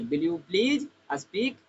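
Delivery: very slow, every word stretched; slow model sentence. Shown as a demonstration. Teacher repeats the request to slow down very slowly. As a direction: drag each word out for learners. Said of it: "इस प्रकार से बोल सकते हैं".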